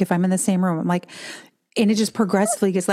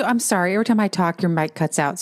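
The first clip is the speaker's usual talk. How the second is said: ordinary talk, nothing marked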